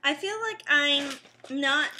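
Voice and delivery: finishing a bite of chip; chewing while talking. Real, not acted. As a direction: mouth partly full. Seen 1: chews at -7, lips pursed chewing until 2.7, then clear.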